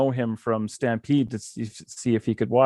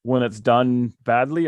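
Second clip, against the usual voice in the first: ordinary talk, nothing marked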